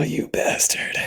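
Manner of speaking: whispered curse. Real, not acted. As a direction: breathe it out in disbelief. Realising the acrostic prank.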